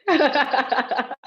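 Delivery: real laugh breaking in after the word. Real, not acted. Apologizing to Miriam for shouting, laughing sheepishly. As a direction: laugh it off, a little embarrassed.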